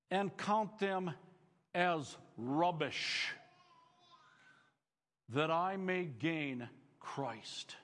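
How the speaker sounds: solemn scripture reading. Acted. Reads verse slowly and solemnly.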